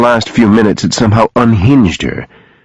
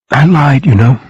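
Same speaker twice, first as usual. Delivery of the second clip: higher-pitched, broken admission; broken confession; 7 semitones higher than usual. A narrator's reading. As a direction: confess as if coming apart. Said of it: "she said brokenly".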